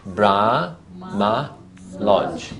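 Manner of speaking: Brahma stretched very slowly and held before Lodge; teacher counting syllables aloud; slow syllable demo. Shown as a demonstration. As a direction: draw Brahma out, pause, then Lodge.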